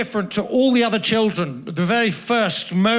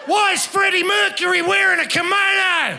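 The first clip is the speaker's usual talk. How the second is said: street bloke's question; 9 semitones higher than usual. Retold or imitated. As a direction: yell it across the street at a stranger. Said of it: "summoned my attention in the following manner".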